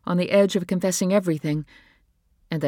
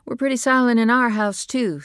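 higher, louder woman's voice; lightly voiced wife; 8 semitones higher, 6 dB louder than usual. A narrator's reading. Wife agreeing with husband, higher and louder. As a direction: lift the voice for the wife. Nora's voice in the story.

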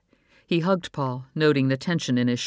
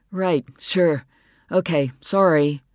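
grudging apology; 3 semitones higher, 5 dB louder, slower than usual. Acted.

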